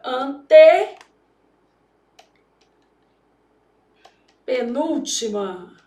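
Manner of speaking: muito devagar, com longa pausa no meio; ditando ao escrever. Shown as a demonstration. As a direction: solte a palavra em pedaços, ao ritmo da escrita. Dita enquanto escreve no quadro. (sílabas esticadas de quem dita)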